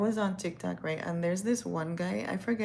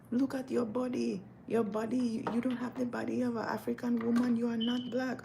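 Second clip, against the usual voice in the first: high, insistent scolding; imitated African lady; 6 semitones higher than usual. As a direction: scold in a high, measured put-on voice. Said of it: "she's like".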